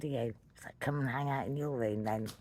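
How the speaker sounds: normal talking voice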